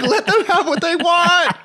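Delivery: high, slow pleading whine; mock panic. Tourist begging guards during the baboon raid, mock panic. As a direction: beg in fake terror.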